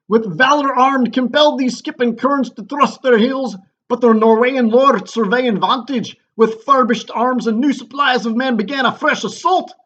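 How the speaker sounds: loud, high, hammed-up Scottish captain; terrible Scottish accent. Wounded captain reports the battle to the king, mock Scottish shout. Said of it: "my terrible Scottish accent when I read the part of the captain".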